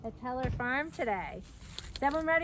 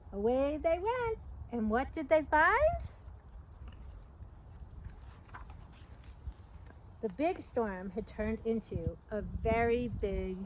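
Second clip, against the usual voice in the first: put-on reading to children. A narrator's reading. Reading The Big Storm aloud to young listeners, lively storyteller. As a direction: read it like story time for little kids.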